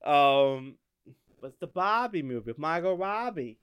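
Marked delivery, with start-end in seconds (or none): 1.5-3.6 higher-pitched Boston demo; Boston accent. Shown as a demonstration. Showing a friend the movie title, Boston accent. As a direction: drop the R in "Bobby", Boston-style. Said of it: "you gotta say it like".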